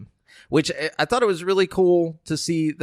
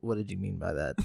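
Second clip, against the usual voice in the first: usual speaking voice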